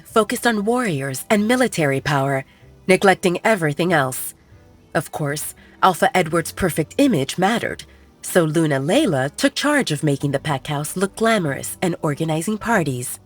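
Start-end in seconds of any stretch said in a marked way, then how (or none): none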